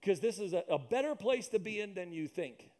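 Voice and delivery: usual speaking voice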